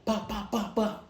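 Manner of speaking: high, clipped "bah, bah, bah" gun sounds; mock gunshots. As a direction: imitate shots firing. Mock shooting noise.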